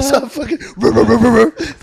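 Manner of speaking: loud revving bruh noises, laughing; pull-start a lawnmower with your mouth; sputtering engine. Retold or imitated.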